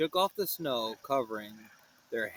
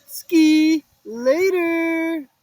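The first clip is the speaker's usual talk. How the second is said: high, loud, very slow shout; Shaggy's long happy cry; drawn-out shout; 11 semitones higher, 9 dB louder, much slower than usual. Acted. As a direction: stretch it out, a gleeful yell. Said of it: "Shaggy shouted".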